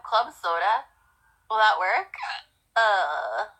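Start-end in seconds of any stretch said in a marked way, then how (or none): none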